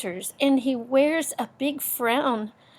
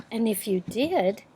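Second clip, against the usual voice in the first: ordinary delivery, nothing marked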